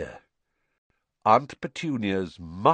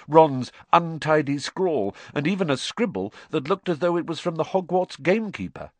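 plain reading voice

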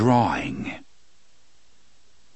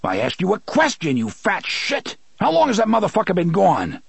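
roaring; 9 semitones higher than usual. A narrator's reading. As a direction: roar it at the clerk. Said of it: "roared at the man".

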